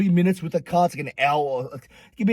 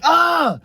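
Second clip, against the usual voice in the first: loud, high mock yelp; re-enacted pained shout; cry of pain; 9 semitones higher, 5 dB louder than usual. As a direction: yelp as if your hand is squeezed. Reacting as hand is squeezed.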